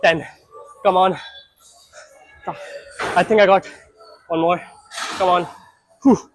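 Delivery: grunting through the last reps; effortful, pushing to failure; straining through reps. Real, not acted.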